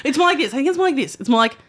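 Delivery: usual speaking voice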